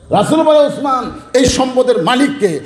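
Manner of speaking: retold insistent asking; insistent repeated question. Second asking, more insistent.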